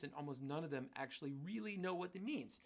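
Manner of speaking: ordinary delivery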